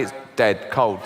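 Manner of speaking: ordinary delivery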